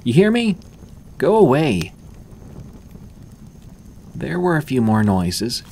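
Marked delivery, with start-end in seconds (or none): 0.0-1.9 loud, much higher shout; a girl shouting at the boy outside, loud and high; angry shout. A narrator's reading. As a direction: bang the door and drive him away. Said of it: "slammed her fist on the door and shouted".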